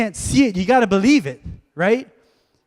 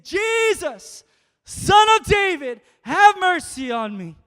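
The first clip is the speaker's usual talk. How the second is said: calling out; 6 semitones higher, 5 dB louder than usual. Acted. Blind man calling to Jesus.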